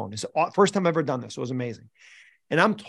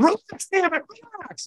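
high strained yell of a frustrated coach; retold angry yell; slower than usual. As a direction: snap at the players to relax. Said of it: "I'm yelling at 'em to relax".

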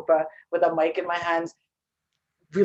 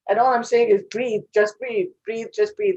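exasperated; 3 semitones higher, 7 dB louder than usual. Retold or imitated. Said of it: "I'm like".

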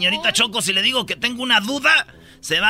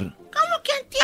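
put-on squeaky kid; 14 semitones higher, 5 dB quieter than usual. Kid voice in school sketch.

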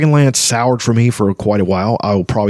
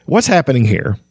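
ordinary talk

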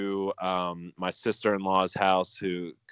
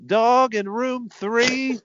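much higher, slow, put-on mocking vet voice; 5 dB louder, slower than usual. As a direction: squeak out the doctor's line slowly, poking fun. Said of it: "as the doctor came in".

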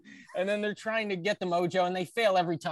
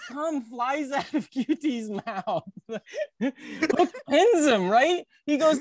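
ordinary delivery

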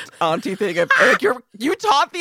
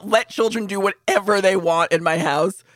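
ordinary talk

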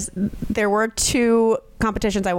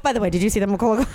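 rushed sign-off; much faster than usual. Retold or imitated. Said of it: "Heartfelt sign off is like".

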